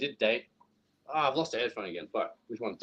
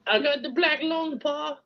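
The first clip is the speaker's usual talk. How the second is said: put-on much higher, wheezy old-timer; high old prospector voice; 14 semitones higher, slower than usual. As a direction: play a sick old miner calling pa. Old miner joke.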